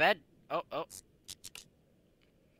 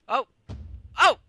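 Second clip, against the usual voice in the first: normal talking voice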